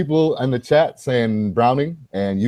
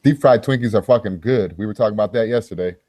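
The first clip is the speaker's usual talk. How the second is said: ordinary talk, nothing marked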